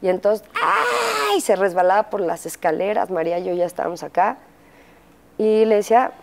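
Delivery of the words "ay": alarmed cry. Retold or imitated. Son slips on the stairs.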